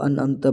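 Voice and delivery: "انعمت" with no throat ain; hamza for ain. Shown as a demonstration. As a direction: say "انعمت" as an-amta. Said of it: "عین کو حمزہ کر دیتے ہیں". ("انعمت" عین کی جگہ حمزہ)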